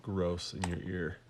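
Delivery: sniffly stuffed voice from a cold. Real, not acted. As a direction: talk through the sniffles. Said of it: "Sorry about the sniffling".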